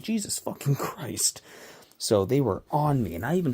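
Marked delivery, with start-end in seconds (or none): 0.0-1.4 disgusted mutter. Retold or imitated. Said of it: "I'm like".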